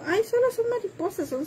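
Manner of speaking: high, careless "solo son mariposas", then settling down; dismissive girl. Retold or imitated. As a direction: mimic the girl brushing it off, starting high. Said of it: "cuando dijo".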